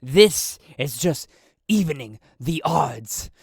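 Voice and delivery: loud, menacing challenge; intimidating. Acted. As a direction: lean in and threaten him. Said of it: "a little bit of intimidation in that voice".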